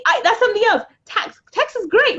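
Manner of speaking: thrilled about paying tax; excited. Real, not acted.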